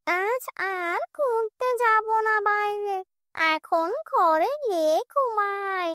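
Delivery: high, slow, satisfied ghoul voice; contented witch voice. Acted. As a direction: savour the meal like a sated spirit. Witch voice in the tree.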